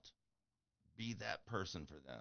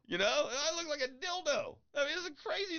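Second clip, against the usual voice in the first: amused complaint; 6 dB louder than usual. Real, not acted. Mocking his own haircut.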